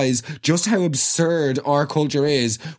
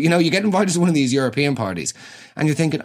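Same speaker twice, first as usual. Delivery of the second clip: normal talking voice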